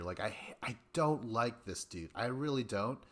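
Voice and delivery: normal talking voice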